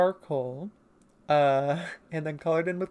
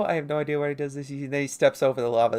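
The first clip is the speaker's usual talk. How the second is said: usual speaking voice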